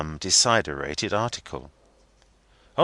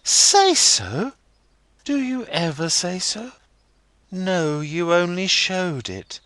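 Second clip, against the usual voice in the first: put-on young girl Rosa; 11 semitones higher, 5 dB louder than usual. A narrator's reading. Rosa voiced in story.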